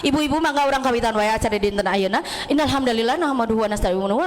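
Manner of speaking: rushed speech opening, words tumbling into one another; far too fast. Shown as a demonstration. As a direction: rattle through the opening at speed. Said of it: "ah, gancang teuing".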